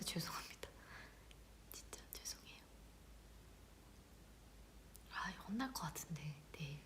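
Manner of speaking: sheepish whispered apology, barely audible; hushed, embarrassed whisper. Real, not acted. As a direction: whisper it as if afraid of getting scolded. Whispered apology after a gaffe.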